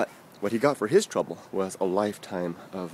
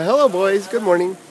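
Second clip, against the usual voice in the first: higher, louder friendly call; calling a greeting; 9 semitones higher, 7 dB louder than usual. Real, not acted. Speaker greeting passing boys mid-story, calling out. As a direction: call out to passers-by.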